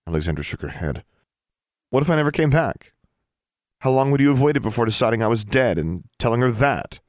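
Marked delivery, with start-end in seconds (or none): 1.9-7.0 upset. Acted. Alexandra confronts the dean.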